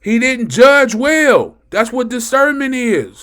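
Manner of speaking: loud, high, impassioned sermon shout; amped-up preaching. Real, not acted. Preacher explains discernment to congregation, amped up and shouting. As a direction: drive the point home at full force. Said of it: "I get so amped up about the word".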